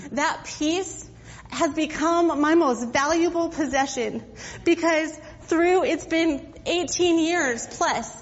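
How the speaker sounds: emotional preaching with a catch in the voice; impassioned testimony. Real, not acted. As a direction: pour out her heart to the church. Preacher testifies about peace.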